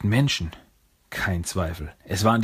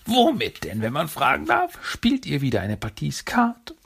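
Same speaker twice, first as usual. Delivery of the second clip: higher, sneering questions; mocking Krang; 7 semitones higher than usual. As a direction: taunt him with mock politeness.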